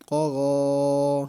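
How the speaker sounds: قا غا with both vowels held one alif long, a heavy ق and غ; slow, heavy, drawn-out قا غا; stretched long vowels. Shown as a demonstration. Teacher stretches the vowels to show the class the length. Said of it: "اس کا وقف بھی ویسے ہی ہوگا".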